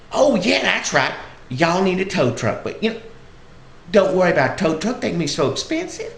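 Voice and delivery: high-pitched Southern granny chatter; Southern old lady. Retold or imitated. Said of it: "sweet little old lady".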